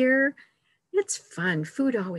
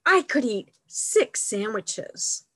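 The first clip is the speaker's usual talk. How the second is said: delighted exclamation; 2 semitones higher, 4 dB louder than usual. A narrator's reading. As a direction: exclaim it brightly as a storybook bear. Reads picture book to children.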